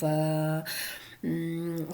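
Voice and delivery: usual speaking voice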